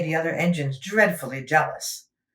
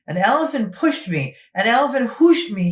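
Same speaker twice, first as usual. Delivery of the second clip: indignant; 6 semitones higher, 4 dB louder than usual. Acted. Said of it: "he hissed".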